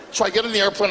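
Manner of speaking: normal talking voice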